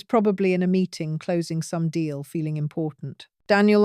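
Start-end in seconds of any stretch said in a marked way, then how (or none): 3.5-3.8 voice cracking. A narrator's reading. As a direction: voice breaks on the name, louder. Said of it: "I let my voice crack on his name".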